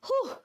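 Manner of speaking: high breathy whew after explaining; tired whew. Real, not acted. Exhale after long explanation.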